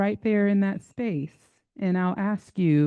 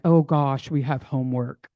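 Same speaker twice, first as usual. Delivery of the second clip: mock grumble; 7 semitones lower than usual. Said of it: "I heard some grumbles in there like".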